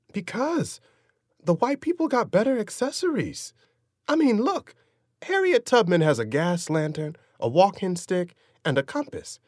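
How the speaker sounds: put-on earnest little boy explaining himself; young boy voice. Boy defends choice to father.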